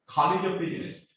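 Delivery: normal talking voice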